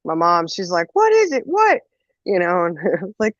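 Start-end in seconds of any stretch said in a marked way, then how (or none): 0.9-1.8 high-pitched imitation of a panicky mom; mom alarmed. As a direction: ask urgently in a high mock-mom voice. Mom alarmed by son's screaming.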